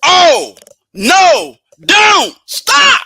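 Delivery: each word separate and loud, "stop" shouted; "Oh," pause, "no," pause, "don't stop" with weight; emphasis and pauses. Shown as a demonstration. Passenger warning the driver, with hard emphasis. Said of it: "when you put emphasis".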